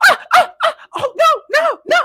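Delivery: high, frantic "no, no"; panicked protest. Retold or imitated. Assistant panicking as boss dumps desk. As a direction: squeal in panic as papers go in the trash.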